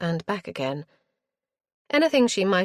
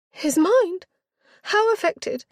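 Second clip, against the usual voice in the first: put-on high, louder girlish alarm; alarmed young girl; 6 dB louder, slower than usual. A narrator's reading. Girl hearing her father's mind is affected, alarmed high voice. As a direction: squeak out the shock. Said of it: "Richenda alarmed at news".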